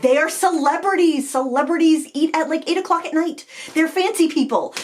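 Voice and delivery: high, insistent fangirl protest; freaking out. Retold or imitated. Starstruck mom corrects her daughter, freaking out. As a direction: argue excitedly, pitch up high. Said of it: "freaking out".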